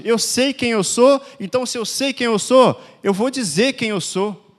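fiery sermon declaration; forceful preaching. Acted. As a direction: preach it with conviction.

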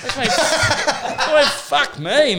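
loud laughing. Real, not acted. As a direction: laugh loudly through it. Laughing at the story.

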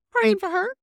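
very high, loud disbelief; hammed-up disbelieving squeal; incredulous. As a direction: shriek the question in mock outrage. Incredulous retold reaction.

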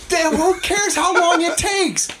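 high, slow, outraged outburst; arguing with friends about a bad TV episode, shouting in exasperation; exasperated shout. Real, not acted. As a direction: blurt it out, fed up with the plot. Heated argument over the show.

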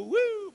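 1 whoop of surprise. Retold or imitated. Boots flying off in the crash.

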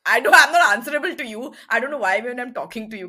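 loud, fast, dismissive snap; abrasive. Retold or imitated. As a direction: fire it off rudely, not answerable. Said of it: "like, abrasive".

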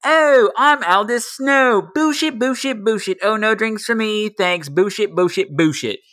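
mock him in a high put-on voice; mocking impression of someone. Woman mocking Aldous Snow in a quoted film rant, high mocking mimicry. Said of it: "right before when she's like".

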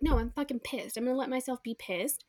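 ordinary talk

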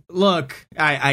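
normal talking voice

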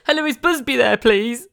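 high, loud prank-call voice, laughing; cheeky kid's phone voice, cracking up; prank caller. Retold or imitated. Childhood caller pranking the operator, high laughing prank voice.

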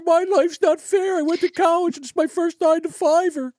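mocking whiny girl. Host mocking the crying TikTok girl, high whiny mimicry. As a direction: whine it like a spoiled girl.

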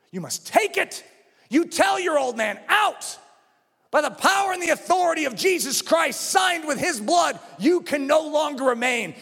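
high, forceful banishing command; fervent, weighty proclamation; commanding preaching. Real, not acted. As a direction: order it out with pulpit authority.

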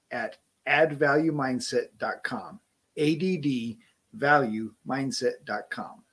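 measured podcast ad read. Acted. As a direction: read it out like a sponsor spot. Sponsor ad read.

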